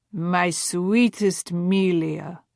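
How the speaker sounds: slow, hammed-up Russian accent for a love letter; a bad mock-Russian reading, drawn out; ridiculous Russian accent. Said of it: "ridiculous attempt at a Russian accent".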